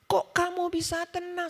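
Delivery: high, slow, amazed retold question; imitating tearful guests. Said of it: "mereka nangis sampai mereka peluk saya".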